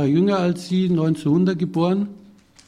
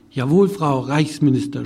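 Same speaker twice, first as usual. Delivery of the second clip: usual speaking voice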